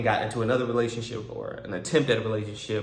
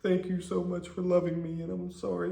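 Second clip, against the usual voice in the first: crying; 7 semitones higher, 3 dB quieter than usual. Retold or imitated. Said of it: "Then she started crying again".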